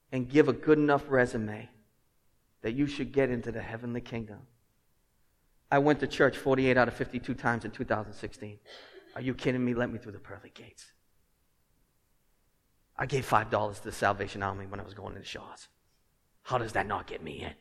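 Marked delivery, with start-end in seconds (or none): 5.7-17.5 low, softer pleading of one's case; lightly acted self-justifying plea; mock self-justification. Churchgoer arguing his way past the pearly gates, mock self-justification. Acting out feeble plea at heaven's gate.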